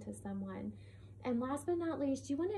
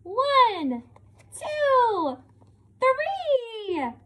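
high, loud, drawn-out counting shouts; exclaimed with expression; 13 semitones higher, 12 dB louder, much slower than usual. Shown as a demonstration. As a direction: shout each number with a big exclamation mark. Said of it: "How would she say it?".

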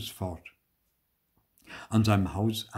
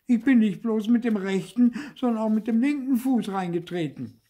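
high, loud puppet protest; indignant back talk; 12 semitones higher, 6 dB louder than usual. A narrator's reading. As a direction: correct her indignantly in a high voice. Trapped puppet talks back to fairy. (hoch und empört)

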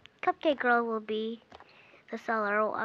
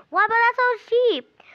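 mock outrage; 10 semitones higher, 9 dB louder than usual. Customer protesting the burger price to the seller, squealed mock outrage.

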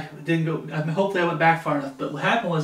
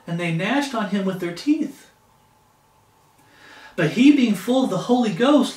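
scripture reading; 3 semitones higher than usual. Acted. Reads scripture aloud.

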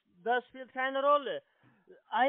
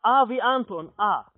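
1 emphatic spelling; 8 dB louder than usual. Real, not acted. Caller repeats letter, losing patience.

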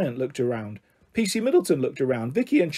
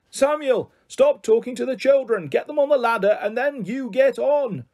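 high-pitched, raised command; bossy megaphone shout; 8 semitones higher than usual. A narrator's reading. Wife shouts orders down at her husband, bossy. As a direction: order him about from high above. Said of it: "was now shouting down at him from seven metres up".

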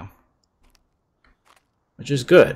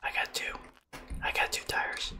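whispering; 20 dB quieter than usual. Real, not acted. Hiding from monster, whispering.

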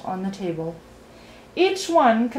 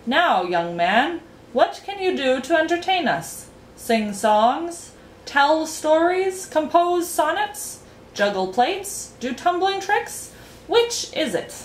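high, drawn-out questioning as a storybook king; quizzing king; 8 semitones higher than usual. A narrator's reading. King demanding entertainment from a young guest, imperiously questioning. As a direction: list each option as a rising challenge.

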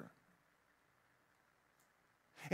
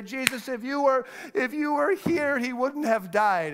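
pleading Martha; 7 semitones higher, 3 dB louder than usual. Acted.

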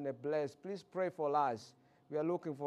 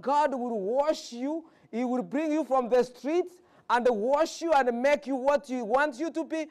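preaching; 7 semitones higher, 6 dB louder than usual. Acted. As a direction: preach it out to the congregation. Preacher lifts voice, slows.

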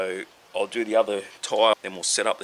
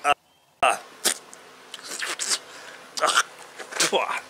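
sour gasp; 6 semitones higher, 5 dB quieter than usual. Real, not acted. Tastes sour citric acid.

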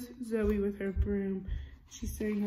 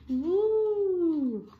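rising and falling high ghostly wooo, higher and louder; ghost woo; 9 semitones higher, 8 dB louder than usual. Acted. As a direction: spook the kid with a long ghost hoot. Ghost noise in story.

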